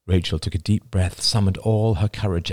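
ordinary delivery, nothing marked

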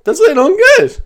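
a comic high-pitched squeak; voice jumping high. Real, not acted. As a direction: let the voice crack up high on purpose. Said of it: "My voice keeps going high as well".

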